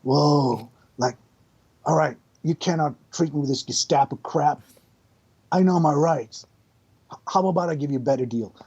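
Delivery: casual, unbothered Keanu cadence; a light Keanu Reeves voice; Keanu Reeves impression. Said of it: "Keanu Reeves".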